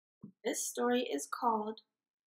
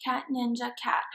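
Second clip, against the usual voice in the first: usual speaking voice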